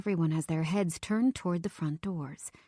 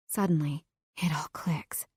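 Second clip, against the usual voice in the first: whispered; 3 semitones lower than usual. A narrator's reading. Realization, whispered.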